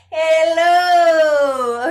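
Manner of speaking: loud high call out; sound ball call. Shown as a demonstration. Voice coach throwing a sound ball to the class. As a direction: fling the word out like a ball. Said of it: "throw a sound ball".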